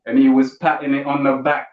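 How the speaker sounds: ordinary talk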